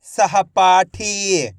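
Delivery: सहपाठी very slow, long paa and thee; blended word demo. Shown as a demonstration. Teacher reading for pupils to repeat, सहपाठी stretched. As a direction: draw out सहपाठी by syllable. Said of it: "आपको मेरे साथ साथ repeat करना है".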